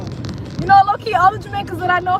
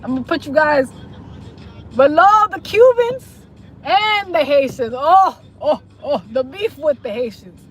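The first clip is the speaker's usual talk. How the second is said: usual speaking voice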